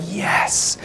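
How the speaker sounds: whispered, breathy yes of delight; hushed cheer. Retold or imitated. Cow owners hearing about the grass, hushed cheer. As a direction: whisper it like a secret win.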